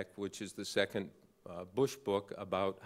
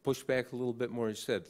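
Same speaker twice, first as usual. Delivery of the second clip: ordinary talk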